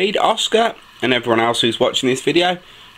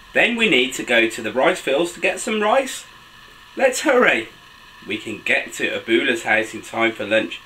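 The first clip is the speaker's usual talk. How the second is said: put-on animated children's story reading, high excited exclamations; picture-book narration; 4 semitones higher than usual. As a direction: read to little ones, pitch leaping between narration and exclamations. Reads picture book aloud to children.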